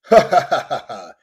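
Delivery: loud, high burst of mock villain laughter; devil's celebrating laugh. Devil gloating over his latest win, theatrical laugh. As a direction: cackle in triumph as the devil. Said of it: "The devil celebrates".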